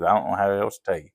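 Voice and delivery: ordinary talk, nothing marked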